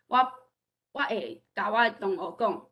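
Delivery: ordinary talk, nothing marked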